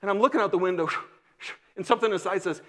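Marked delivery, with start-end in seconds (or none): 0.9-1.5 car whoosh. Retold or imitated. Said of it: "they make that sound".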